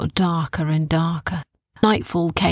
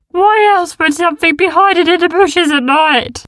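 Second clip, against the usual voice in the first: nervous beast; 11 semitones higher, 9 dB louder than usual. A narrator's reading. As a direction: fret from behind the rock. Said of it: "said the big one".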